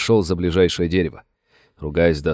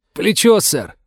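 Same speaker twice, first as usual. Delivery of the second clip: called back; 10 semitones higher, slower than usual. Acted. Wounded soldier answering the sheriff, shouting. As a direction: yell the answer back through pain.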